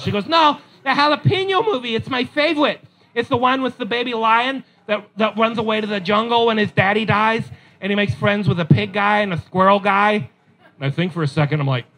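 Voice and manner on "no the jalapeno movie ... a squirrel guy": put-on high, sweet toddler voice; lightly voiced small girl asking for a movie; little girl. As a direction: ask like an excited three-year-old. Daughter voiced by dad.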